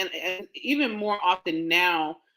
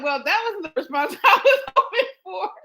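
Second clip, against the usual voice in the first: higher, louder doctor voice with laughter; imitating the doctor; 9 semitones higher, 4 dB louder than usual. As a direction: redo her line as a lightly voiced doctor. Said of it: "uh, she said".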